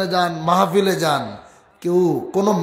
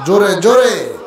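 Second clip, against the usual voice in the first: urging louder; 2 semitones higher, 6 dB louder than usual. Real, not acted. Urging crowd to answer louder.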